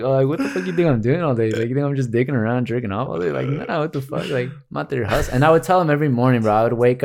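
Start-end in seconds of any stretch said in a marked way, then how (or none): 0.0-5.3 higher, exasperated comeback; indignant retort. Retold or imitated. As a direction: defend yourself to your parents, half joking. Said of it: "and I'm like".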